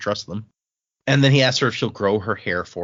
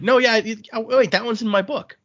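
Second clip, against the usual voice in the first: higher, louder burst of recognition; retold "oh wait" moment, pitched up; sudden realization; 12 semitones higher, 3 dB louder than usual. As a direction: act out suddenly remembering. Quoting his sudden recognition.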